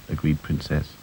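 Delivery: plain reading voice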